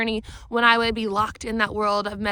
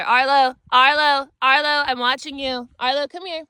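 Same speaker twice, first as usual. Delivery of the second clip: calling the dog; 3 semitones higher, 6 dB louder than usual. Real, not acted. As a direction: call the dog across the room.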